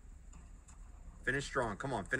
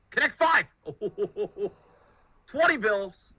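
excited shout; 6 semitones higher, 6 dB louder than usual. Real, not acted. Bonus hitting, excited shout.

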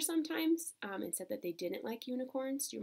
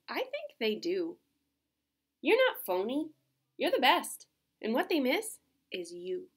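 lively storybook voice with character turns; reading to children. Acted. Reading Otis cheering Thelma on, storybook voice. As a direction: bring Otis and Thelma to life.

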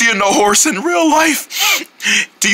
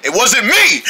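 normal talking voice

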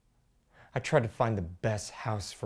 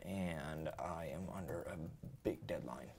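whispering; 6 semitones lower, 15 dB quieter than usual. Acted. Husband hurrying through work talk.

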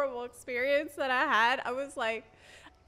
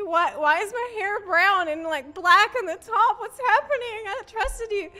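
building higher and louder to a desperate what's happening; mock betrayed, rising panic; upset complaint; 6 semitones higher, 6 dB louder than usual. Confronting the stylist, rising upset.

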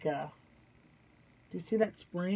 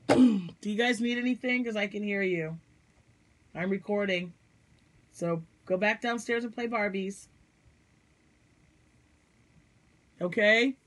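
calling downstairs; 4 semitones higher, 5 dB louder than usual. Real, not acted. Mom calls down to kids interrupting her recording, raised voice.

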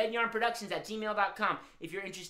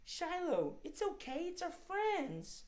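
soft coaxing; 11 semitones higher, 6 dB quieter, slower than usual. Acted. Coaxing shy puppet squirrel.

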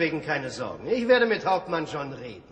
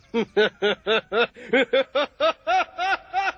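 long high villain cackle; evil laugh; 9 semitones higher, 3 dB louder than usual. Acted. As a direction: gloat with a wicked laugh. Schurke triumphiert allein. (lang gezogenes, hämisches Schurkenlachen)

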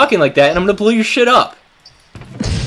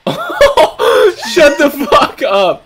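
laughing; 10 semitones higher, much slower than usual. Real, not acted. Disbelieving laugh.

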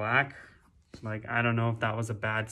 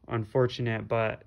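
ordinary talk, nothing marked

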